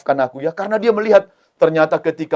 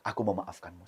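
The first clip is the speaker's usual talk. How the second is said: tender forgiveness; 5 semitones lower, 16 dB quieter than usual. Acted. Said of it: "Rupanya abangnya bilang".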